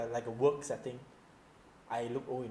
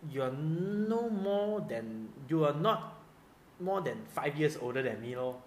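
indignant retort, "no more" drawn right out; mock indignation; 4 dB louder, slower than usual. Man silently answering the mother who aged him, mock indignant. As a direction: protest with slow, stretched emphasis. His indignant inner reply, retold.

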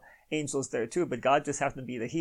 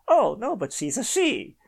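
mocking quote; 7 semitones higher, 5 dB louder than usual.

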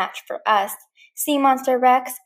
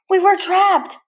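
high, loud panicked cry; alarmed cry; 8 semitones higher, 6 dB louder, slower than usual. Acted. Woody caught by tentacles, alarmed cry. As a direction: cry out as you're grabbed.

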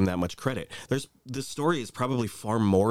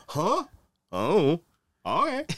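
dopey reply; 5 semitones higher, 4 dB louder, much slower than usual. Retold or imitated. Imitating absent-minded Trump answer.

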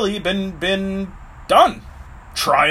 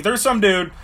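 ordinary delivery

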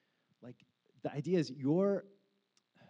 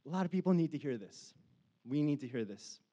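usual speaking voice

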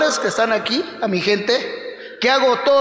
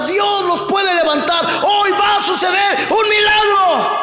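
preaching at full force; 6 semitones higher than usual. Real, not acted. Preacher proclaiming hope to the sick, full fervent force.

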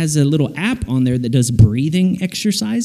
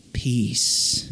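low, very soft single word; hushed calm; 6 semitones lower, 10 dB quieter than usual. Real, not acted. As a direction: let the word settle quietly. Soothing promise of peace.

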